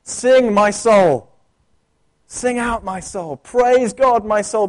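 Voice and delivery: calling out to his own soul, loud bursts; David rallying his soul to praise; psalmist's call. Retold or imitated. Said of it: "David does in the Psalms".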